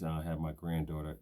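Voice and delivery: ordinary talk, nothing marked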